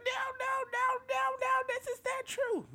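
chihuahua yap. Retold or imitated. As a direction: squeal it like a frantic chihuahua. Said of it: "She sounds like a chihuahua".

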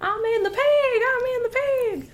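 high Southern character voice; Jamie Presley. Shown as a demonstration. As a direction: stretch it out in a high Southern twang. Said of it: "keep using that line over in practice".

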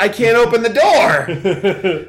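put-on a child flustered and stuck; mock panic. Kid panicking in retelling.

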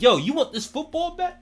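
mock tough New Yorker. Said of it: "'cause I'm like".

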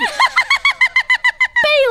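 squealing high laugh; high giggle. Real, not acted. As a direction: burst out giggling. Bailiff laughs at Fartberg.

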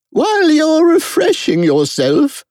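very high royal voice; brisk Queen. A narrator's reading.

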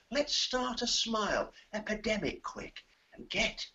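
soft, gentle poem reading; poem recital. Acted. Reciting a Milligan poem to the host, soft and gentle. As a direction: recite it softly to cheer them.

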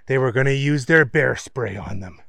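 plain reading voice

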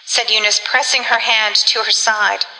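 ordinary delivery, nothing marked

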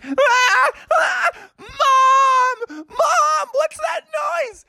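put-on very high screaming child voice; panicked cry. Froggy yells to mom about the noise, panicked. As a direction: shriek for help in hammed-up terror.

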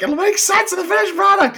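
very high acted reassurance; mock placating. Exasperated writers to a difficult actor, mock placating. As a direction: pitch it high, fobbing him off.

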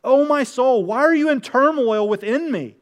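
anguished self-questioning. Acted. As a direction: plead with your own soul. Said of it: "They're like".

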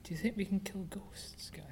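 hushed conspiratorial whisper. Pretend. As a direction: whisper so the ghost can't hear. Whispering about ghost.

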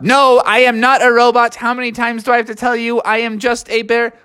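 indignant high robot monotone; high-pitched, level robotic denial; Techno Bear. Acted. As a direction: protest in an even robot voice. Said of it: "are you actually a robot?".

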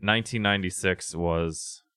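ordinary delivery

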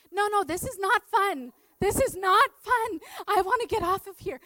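loud, high pleading complaint; desperate, exaggerated objection to being stuck; acted protest. Acted protest at the boat crew.

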